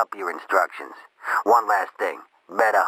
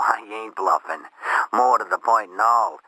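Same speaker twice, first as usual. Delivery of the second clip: usual speaking voice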